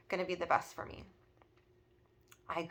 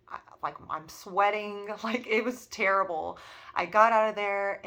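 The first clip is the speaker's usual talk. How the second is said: ordinary talk, nothing marked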